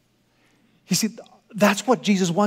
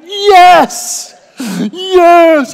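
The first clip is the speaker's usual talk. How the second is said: high, loud cheering as if overjoyed; hammed-up joyous shout; mock excited cheer; 10 semitones higher, 11 dB louder than usual. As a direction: cry out yes like a thrilled wife. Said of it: "really excited about that".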